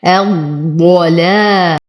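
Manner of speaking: الضلال with a heavy ض and light لام; slow tajweed demo. Shown as a demonstration. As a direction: recite الضلال slowly, ض heavy. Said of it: "تشديد الضاد المفخمة". (ضاد مفخمة ولام مرققة)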